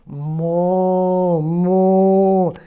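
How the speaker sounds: drawn-out cow moos; mooing cow. A narrator's reading. Storyteller mooing like a cow on the farm. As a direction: moo like a farmyard cow.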